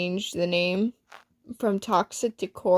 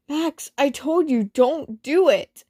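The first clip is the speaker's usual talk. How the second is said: scolding; 6 semitones higher, 3 dB louder than usual. Acted. Acted quarrel between Zane and Max.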